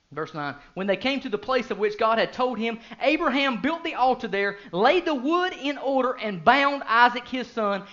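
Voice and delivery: loud, high, impassioned preaching; full-force preaching. Acted. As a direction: preach it out to the whole congregation. Preaching verse nine and aftermath.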